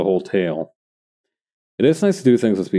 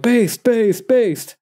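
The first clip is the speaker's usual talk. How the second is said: hyped fan voice; 12 semitones higher, much slower than usual. Retold or imitated. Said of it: "somebody might be like".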